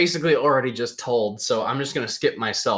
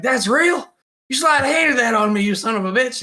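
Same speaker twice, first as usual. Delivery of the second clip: high, incredulous retold protest; mock indignation; 12 semitones higher, 4 dB louder than usual. Retold indignant reaction to trick.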